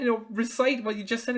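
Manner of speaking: normal talking voice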